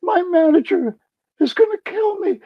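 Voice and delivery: panicked store clerk. Retold or imitated. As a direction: squeak it out like a terrified kid. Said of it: "he starts hyperventilating".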